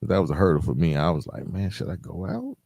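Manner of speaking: ordinary talk, nothing marked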